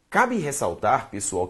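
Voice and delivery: normal talking voice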